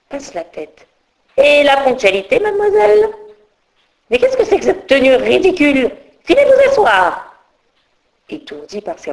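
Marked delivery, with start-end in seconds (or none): 1.4-7.3 high, loud scolding teacher voice; teacher scolding the late fairy, high and loud; stern schoolmistress. Acted.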